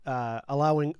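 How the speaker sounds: ordinary talk, nothing marked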